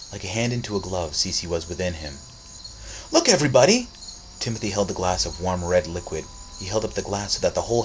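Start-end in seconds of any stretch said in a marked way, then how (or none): 3.1-3.9 excited call. Acted. Boy calling out to party.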